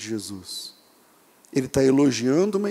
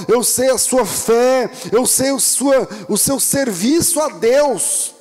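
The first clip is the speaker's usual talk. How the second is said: loud, raised preacher's voice; full-force preaching; 7 semitones higher, 4 dB louder than usual. Acted. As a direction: preach it with rising fervour to the church.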